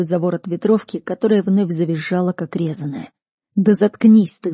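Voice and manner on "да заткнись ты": loud, angry snap; growled order. A narrator's reading. Man muffling the screaming girl, angry growled order. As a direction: shut them up with a raised voice. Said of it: "зарычал я".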